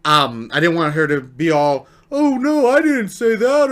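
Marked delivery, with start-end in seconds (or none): 2.1-3.7 high-pitched mock protest; hammed-up embarrassed denial; imitating flustered girl. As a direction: put on a higher girl's voice, flustered.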